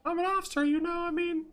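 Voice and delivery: very high falsetto character; high-pitched put-on voice. Voices a character in visual novel.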